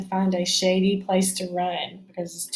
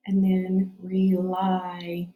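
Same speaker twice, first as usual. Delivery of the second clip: drawn out slowly, sound by sound; slow stretched word; much slower than usual. Shown as a demonstration.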